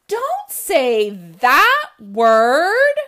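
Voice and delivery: loud, very slow refrain; comic refrain shout. A narrator's reading. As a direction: drag out the refrain loudly.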